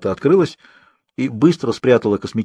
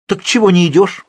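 louder, high impatient challenge; out of patience; 9 semitones higher, 5 dB louder than usual. A narrator's reading. Hairdresser challenging the girl, loudly impatient. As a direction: lose patience and raise your voice. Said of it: "потеряла терпение парикмахерша".

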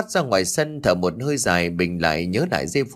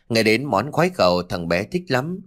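ordinary delivery

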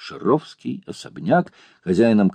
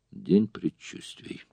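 muttering; 9 dB quieter, slower than usual. A narrator's reading. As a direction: mutter it half to herself. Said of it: "пробормотала Таня".

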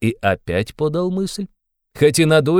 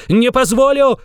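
very high, loud shouted refusal; shouting again; 12 semitones higher, 5 dB louder than usual. A narrator's reading. Raskolnikov shouting at Porfiry a second time. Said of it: "крикнул было другой раз Раскольников".

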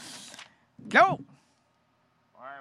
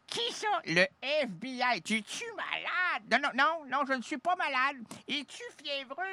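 mock other voice; 7 semitones higher, 11 dB quieter than usual. Acted dialogue with a colleague.

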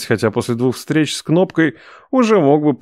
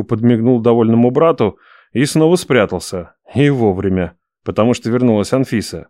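ordinary talk

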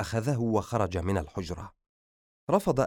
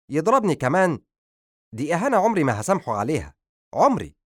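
loud, higher wounded fury; furious; 5 semitones higher, 5 dB louder, faster than usual. Acted. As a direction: hurl out the insult. Son refuses to calm down.

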